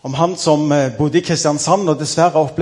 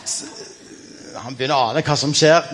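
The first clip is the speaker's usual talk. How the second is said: ordinary delivery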